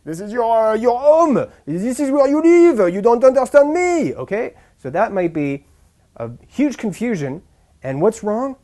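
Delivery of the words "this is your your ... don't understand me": French tourist getting louder and higher; frustrated French accent, rising in pitch and volume; exasperated French accent. Acted. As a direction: protest in a French accent, building to a high, loud outburst. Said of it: "the French person might say".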